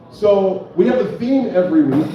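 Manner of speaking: ordinary talk, nothing marked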